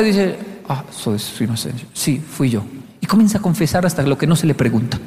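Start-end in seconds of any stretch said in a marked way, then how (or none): 0.7-2.6 low, soft admission; meek confession. Retold or imitated. Japanese man admitting it when asked, meek confession. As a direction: own up humbly, dropping the voice.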